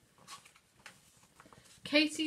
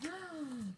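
soft high gasp of wonder; 8 semitones higher, 10 dB quieter than usual. A narrator's reading. Stepping into the painting.

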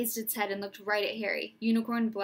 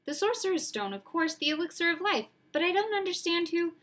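put-on young boy; 8 semitones higher, 5 dB louder than usual. Harry's voice, rising.

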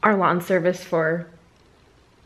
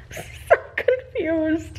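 slow, soft words through laughter; real helpless giggle, voice thinned and high; laughing bewilderment; 7 semitones higher, 6 dB quieter, much slower than usual. Real, not acted. Vlogger looking back on the odd encounter, laughing. Laughing over her own confusion.

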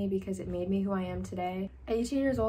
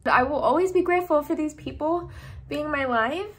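real teary laugh, louder, higher; emotional laugh breaking into tears; laughing through tears; 7 semitones higher, 5 dB louder than usual. Real, not acted. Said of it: "I'm gonna cry".

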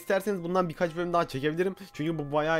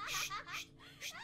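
"shh" hissed in little bursts; hushing; 16 dB quieter than usual. Pretend. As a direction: shush the laughing girl quietly. Hushing the mad girl.

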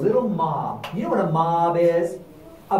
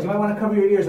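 plain reading voice

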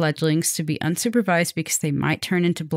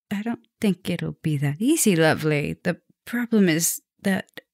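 ordinary talk

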